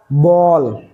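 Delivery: normal talking voice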